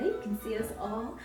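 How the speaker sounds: ordinary delivery, nothing marked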